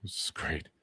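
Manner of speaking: soft unvoiced whisper to himself. Real, not acted. Muttering an aside to the audience, whispered. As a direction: breathe it out as a private aside. Aside under his breath.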